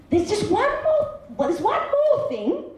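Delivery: high, hesitant plea; pleading son. Acted. Son trying to confess to father.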